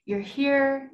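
normal talking voice

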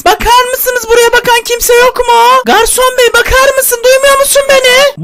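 high, rising calls for the waiter; calling out. Acted. Customer calling an ignored waiter.

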